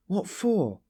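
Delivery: high, startled question; amazed. A narrator's reading. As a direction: blurt it out in shock. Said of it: "asked Sonia, amazed".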